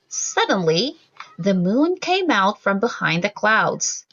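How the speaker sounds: ordinary delivery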